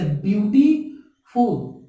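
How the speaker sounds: "Beautiful" broken into beauty, then फुल; split into syllables. Shown as a demonstration. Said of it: "एक से ज्यादा स्वर निकल जाए".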